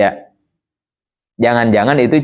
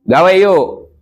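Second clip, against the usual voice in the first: pushy demand; 5 semitones higher than usual. Acted. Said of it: "Abi ke Bayu, maksa".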